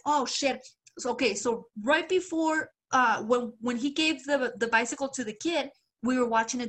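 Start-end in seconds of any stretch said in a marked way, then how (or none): none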